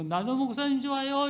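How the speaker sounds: put-on cheerful child calling out to the pastor; a hint of a happy child. Child voiced running to pastor. (목사님을 부르는 아이 흉내)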